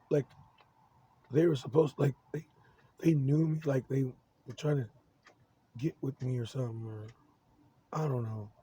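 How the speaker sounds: usual speaking voice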